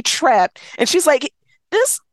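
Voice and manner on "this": quick, higher griping quote; a hint of an irritated young wife; exasperated friend. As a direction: vent about the husband, rushed. Friend complaining about husband, retold.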